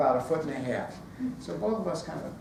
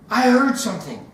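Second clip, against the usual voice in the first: louder, higher sudden alert; alarmed; 5 semitones higher, 8 dB louder than usual. Retold or imitated. Selena to her friends from the doorway, alarmed. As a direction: blurt out a startled warning. Acted startled warning from the doorway.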